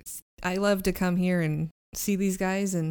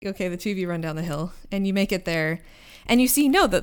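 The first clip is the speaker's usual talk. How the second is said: ordinary delivery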